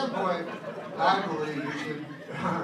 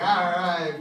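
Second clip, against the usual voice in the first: eager all right; 2 semitones higher, 7 dB louder than usual. Retold or imitated. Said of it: "I'm like".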